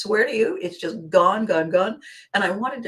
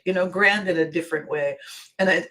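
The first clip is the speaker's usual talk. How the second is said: normal talking voice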